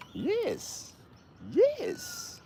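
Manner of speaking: very high, tiny cooing yes; squeaky cooing. Real, not acted. Owner cooing praise at his dog, very high squeaky voice.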